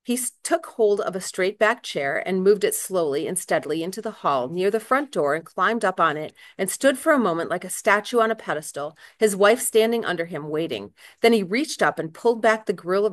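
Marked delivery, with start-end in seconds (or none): none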